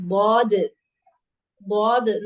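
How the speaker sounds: ضاد said loud, heavy and drawn out; teacher naming ضاد firmly; letter name dad. Shown as a demonstration. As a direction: announce ضاد clearly to the class. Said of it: "Now third heavy letter".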